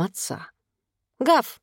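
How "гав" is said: small child playing puppy, high-pitched; high boyish "woof"; playful dog bark. A narrator's reading. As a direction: bark the word like a little boy being a dog. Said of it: "пролаял он и задышал по собачьи".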